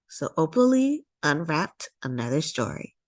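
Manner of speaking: ordinary delivery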